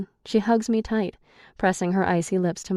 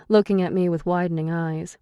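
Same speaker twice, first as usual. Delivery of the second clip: ordinary delivery, nothing marked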